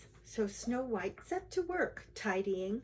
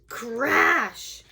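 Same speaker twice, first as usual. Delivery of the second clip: loud, drawn-out exclamation; explosive storytelling shout; dramatic crash; 5 semitones higher, 10 dB louder than usual. A narrator's reading. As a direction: burst it out like the collision. Narrator dramatising the collision.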